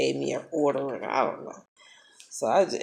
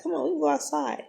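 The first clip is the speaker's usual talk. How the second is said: much higher, quick sing-song invite; coaxing again; 12 semitones higher, faster than usual. Retold or imitated. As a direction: chirp it brightly to lure him out.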